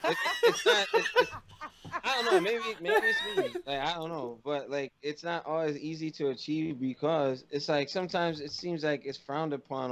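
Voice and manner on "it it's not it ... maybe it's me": high, flustered laughing protest. Real, not acted. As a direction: laugh it off nervously.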